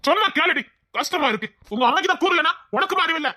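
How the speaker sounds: high-pitched, exasperated telling-off; exasperated scolding. Acted. என்ன திட்டிக்கிட்டே. (எரிச்சலோடு திட்டுதல்)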